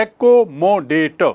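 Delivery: एकोमोडेट slow, four well-separated beats; syllable by syllable. Shown as a demonstration. Teacher repeating the count, एकोमोडेट split syllable by syllable. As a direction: break एकोमोडेट at every vowel. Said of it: "चार बार आ रहा है".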